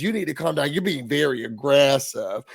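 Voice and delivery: mock her condescending calm; mimicking Shamia. Retelling Shamia scolding Britt, mild dismissive mimicry. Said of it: "Shamia says".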